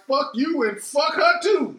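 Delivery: slow, high, loud mock-angry shout. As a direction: spit it out slow like a bitter ex. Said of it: "and I'm like".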